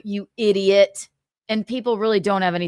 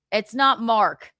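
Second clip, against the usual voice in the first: normal talking voice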